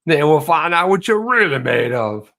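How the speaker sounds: mock cocky challenger, pitched a little up; hammed-up voice of a boastful banker; put-on knucklehead voice. Host showing the voice he launched into, mock boastful. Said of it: "That was just the voice I launched into".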